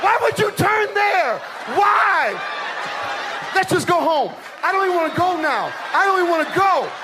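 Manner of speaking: high, exasperated nagging wife. Retold or imitated. Wife scolding husband for a wrong turn, high and exasperated. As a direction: squeal like a fed-up wife in the car. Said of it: "This is you with your wife".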